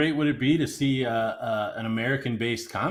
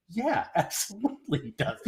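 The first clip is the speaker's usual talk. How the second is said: laughing as he vouches for it; laughing yes; 7 semitones higher, 4 dB quieter than usual. Real, not acted. To caller asking about the accent, laughing while confirming. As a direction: chuckle through the answer.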